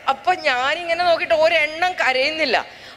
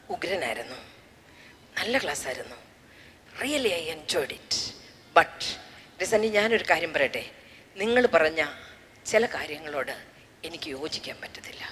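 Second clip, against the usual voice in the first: a lower, softer, hushed schoolboy; a timid boy confiding after class; a hint of a shy schoolboy, fading to a whisper; 10 semitones lower, 8 dB quieter than usual. Schoolboy voiced in story.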